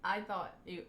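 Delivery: normal talking voice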